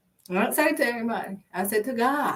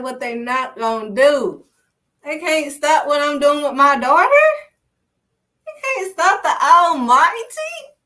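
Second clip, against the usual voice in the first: triumphant declaration; 4 semitones higher, 5 dB louder than usual. Acted. Voicing God's declaration.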